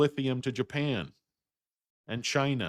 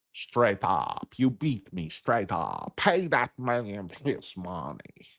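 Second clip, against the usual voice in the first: slow, drawled Teddy KGB from Rounders; a poker boss handing over the pot, slow and smug; Teddy KGB impression; 4 semitones lower, much slower than usual. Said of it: "What kind of accent is that, Teddy?".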